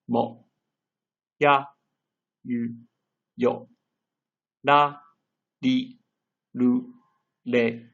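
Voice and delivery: each kana syllable said clearly with a pause after it; slow teacher drill, syllable by syllable. Shown as a demonstration. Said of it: "So let's practice".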